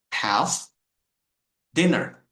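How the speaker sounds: "Have" then a gap before "dinner"; said separately. Shown as a demonstration. Teacher repeating have dinner with a clear break. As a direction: pause between "Have" and "dinner". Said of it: "So it is separated".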